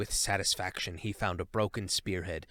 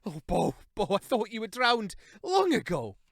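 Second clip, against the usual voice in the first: tearful relief; 11 semitones higher, 5 dB louder than usual. A narrator's reading. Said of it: "weeping unashamedly".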